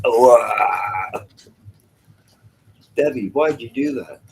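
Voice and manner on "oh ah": loud drawn-out "oh, ah" wail; mock horrified cry. Man finding out it's his grandmother, loud mock horror. Acts shock at spirit being grandmother.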